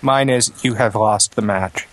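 ordinary delivery

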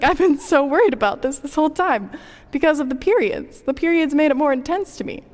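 imitating her past frazzled self, pitched high; worried exclamation. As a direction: replay your own overwrought relief. Retelling own panic to boss.

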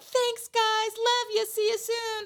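high, chirpy farewell; sing-song goodbye. Retold or imitated. Happy goodbye to the restaurant staff, high and chirpy.

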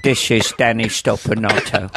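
put-on small boy with an old Cockney actor's voice; thick, put-on Cockney greeting; Michael Caine. Little brother answering dad, Michael Caine voice. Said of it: "Michael Caine".

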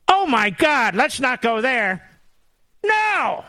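high, drawn-out scandalized cry; mock horror. Host reacts to Pelosi toilet question, mock horror. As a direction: recoil in high-pitched mock shock.

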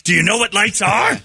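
high mock shout; mock yell. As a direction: bark it like a cranky dad. Retelling father yelling.

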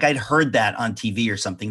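ordinary delivery

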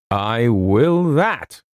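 slow, hearty Scottish agent; jovial Scotsman. Acted. Agent reassuring the worried author, jovially. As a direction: drawl it out with broad good cheer. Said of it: "said Mister McKinnon jovially".

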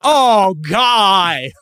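hammed-up American accent, high and loud; mock New Zealander doing American. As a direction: gush it like a Kiwi faking an American. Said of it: "my impression of your impression of an American accent".

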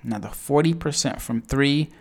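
ordinary delivery

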